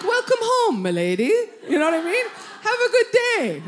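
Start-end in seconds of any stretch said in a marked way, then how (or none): 0.0-1.3 high swoop dropping on "my lady"; loud, lilting butler greeting; butler ghost. Acted. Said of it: "Like a butler ghost". 2.6-3.6 high, loud, lilting farewell; singsong butler send-off; butler ghost. Acted. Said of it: "Like a butler ghost".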